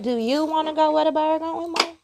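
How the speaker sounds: imitating her son. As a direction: parrot the kid's question playfully. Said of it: "He like".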